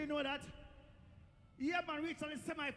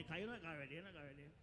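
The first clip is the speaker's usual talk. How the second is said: much lower, softer, trailing away; low muttered aside; 10 semitones lower, 9 dB quieter, much faster than usual. Retold or imitated. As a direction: drop it to a private aside. Knowing aside on taking money.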